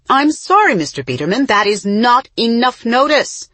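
sharp, high and loud; barking. A narrator's reading. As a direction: bark it into the phone. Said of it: "Mama barked into her cell phone".

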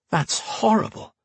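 high, slow horrified gasp of words; shocked and appalled, drawn out. A narrator's reading. Matthew shuddered, horrified.

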